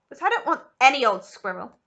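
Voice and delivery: loud bratty shout. Acted. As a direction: spoilt girl shouting back at her mother. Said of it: "Veruca shouted".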